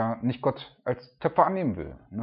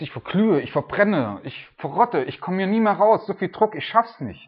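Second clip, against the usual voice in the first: higher, louder desperate wail; mock despair, voice climbing; panicked cry; 7 semitones higher, 4 dB louder than usual. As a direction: cry out as if burning in the kiln. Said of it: "Und du denkst".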